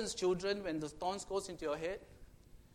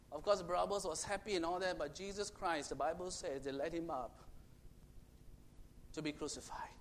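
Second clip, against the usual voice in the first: ordinary delivery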